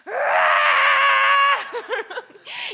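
big roar breaking into a laugh; loud roar. Shown as a demonstration. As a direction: roar like a beast with the kids, then crack up. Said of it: "Should we do it together?".

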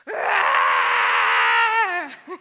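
a full roaring shout with the group; loud roar. Shown as a demonstration. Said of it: "Are you ready? One, two, three".

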